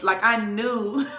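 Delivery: breaking into a real laugh mid-line. Real, not acted. Amused at her own shapeshifting, laughing. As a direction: laugh at herself as she speaks. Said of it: "see how my voice".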